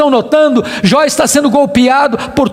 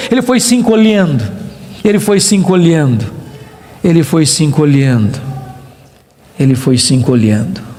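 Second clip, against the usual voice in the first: each repeat lower, down to a deep murmur; sinking far lower with every repetition; shrinking away; 9 semitones lower than usual. Shown as a demonstration. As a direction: let the voice shrink down step by step. Repeats phrase to enact shrinking.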